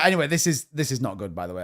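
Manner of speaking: ordinary delivery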